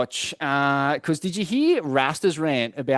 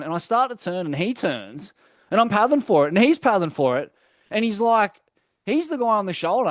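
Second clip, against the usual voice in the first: ordinary delivery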